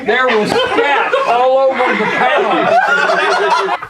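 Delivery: slow, laughing through the words; can't hold the laugh in. Real, not acted. Laughing at own joke.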